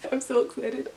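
usual speaking voice

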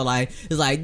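usual speaking voice

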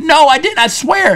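high-pitched, pleading retold protest; panicked denial. Accused prankster to laughing buddies, re-enacted panicked denial. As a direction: swear innocence in a hurry to be believed.

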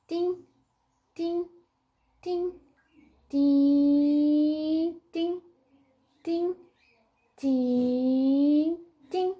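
high "tim" notes, some short, some held; rhythmic short and long tings. Shown as a demonstration. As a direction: sing out dots and dashes on "tim".

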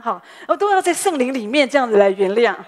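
loud, laughing through the words; laughing aside. Real, not acted. Pastor's wife jokes to the congregation, laughing. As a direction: chuckle as you make the joke. Laughing at her own forgiveness joke.

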